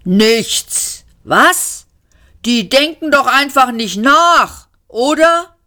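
loud, high indignant ranting; incensed war machine; outraged booming. A narrator's reading. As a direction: thunder in disbelief, climbing at the end.

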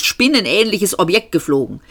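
ordinary delivery, nothing marked

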